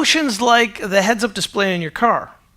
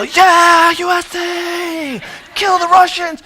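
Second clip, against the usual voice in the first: mock stadium cheering, much higher and louder; crowd cheer; 9 semitones higher, 6 dB louder, much slower than usual. Eighties kid cheering with the Rocky crowd, loud mock chant.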